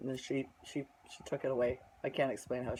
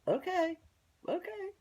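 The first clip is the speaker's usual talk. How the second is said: very high, sing-song okay; pitched way up, dismissive placating; mock agreement. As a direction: humour someone in a squeaky-high voice. Humouring the neighbor, retold.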